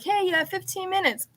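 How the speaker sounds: high, chipper time cue; mock brisk warning. Publicist cutting the interview short, chipper warning. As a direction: play the publicist hurrying them along. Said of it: "it's like".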